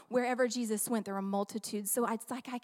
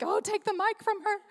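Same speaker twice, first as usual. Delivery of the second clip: higher, teasing exasperated aside; mock pastor aside; 8 semitones higher than usual. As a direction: play the pastor wanting her gone. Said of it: "Pastor Thomas is already like".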